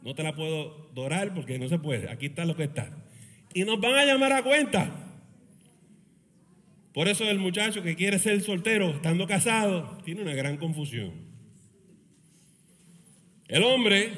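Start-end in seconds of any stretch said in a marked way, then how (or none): none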